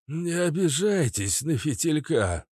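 put-on high, slow, hoarse old performer. A narrator's reading. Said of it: "сказал охрипшим голосом принц". (охрипшим голосом, протяжно)

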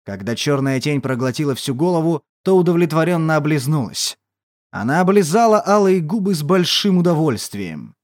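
ordinary delivery, nothing marked

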